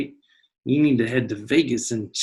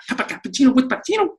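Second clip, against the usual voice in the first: even faster, pitched much higher; faster and higher tongue twister; 11 semitones higher, much faster than usual. Shown as a demonstration. Teacher repeats the tongue twister faster, higher. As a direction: speed it up and climb in pitch. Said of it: "Say that a couple times fast".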